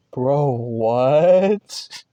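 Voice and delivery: incredulous, rising on WHAT; baffled retort, imitating own confused reaction; baffled disbelief. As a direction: thrown and disbelieving. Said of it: "I'm just so confused".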